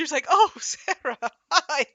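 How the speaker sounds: high, loud, laughing oh Sarah hi; laughing recognition. Retold or imitated. Grandma finally recognising her granddaughter, laughing.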